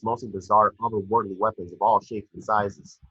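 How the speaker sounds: ordinary talk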